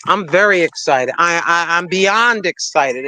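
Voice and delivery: ordinary talk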